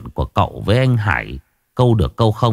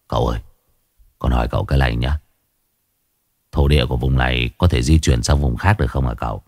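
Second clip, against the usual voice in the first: lower, deferential servant voice; 10 semitones lower, faster than usual. A narrator's reading. Hải Nghẹo asks his master.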